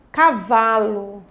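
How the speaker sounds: "cavalo" slowly split as ca-va-lo; syllable by syllable. Shown as a demonstration. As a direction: draw out each syllable of "cavalo". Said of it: "Então vamos separar". ("cavalo" devagar, separando as sílabas)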